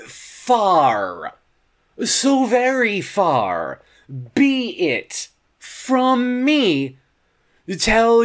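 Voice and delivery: very slow, drawn-out mock disclaimer; sarcastic protest. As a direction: stretch each phrase out with pointed indignation. Sarcastic protest against the blame.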